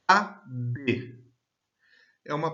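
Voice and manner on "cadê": "Cadê" in two separate pulses, CA, pause, DÊ; split into syllables. Shown as a demonstration. Teacher counting voice pulses for students, "Cadê" syllable by syllable. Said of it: "que tem duas emissões de voz".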